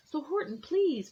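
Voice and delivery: high, pleading please; a touch of begging. A narrator's reading. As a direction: beg him not to leave. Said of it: "pleaded that voice".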